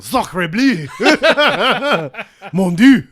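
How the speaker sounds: exaggerated French voice, laughing; French accent. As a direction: ham up an outraged Frenchman. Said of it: "if he went to Paris".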